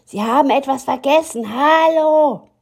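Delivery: high, loud calls after the bus; the story yelling that he forgot his umbrella; calling out. Acted. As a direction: shout after the departing man. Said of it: "schrie die Geschichte".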